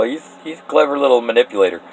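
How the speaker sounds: ordinary talk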